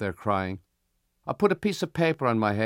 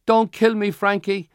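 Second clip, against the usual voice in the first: high, loud frightened plea; loud, high-pitched begging cry; 11 semitones higher, 8 dB louder than usual. Acted. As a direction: plead like a scared little boy. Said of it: "Malachi on the swing cries".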